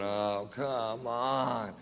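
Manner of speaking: slow, drawn-out exasperated groan of words; quiet, long-suffering complaint. Retold or imitated. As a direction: drag it out, fed up with waiting. Waiting during long plane search.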